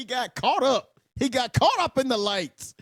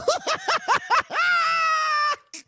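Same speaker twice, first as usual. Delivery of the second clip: high, shrieking burst of laughter; a real laughing shriek at the screen; howling laugh; 3 dB louder than usual. Real, not acted. Viewer watching the fight howls with laughter.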